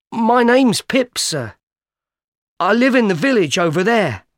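put-on a scared child answering quickly; frightened boy. A narrator's reading. Pip answering the convict, nervous and high.

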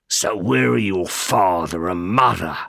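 put-on a rough stranger questioning the boy; menacing convict. A narrator's reading. Convict questioning Pip on the marsh, low menace.

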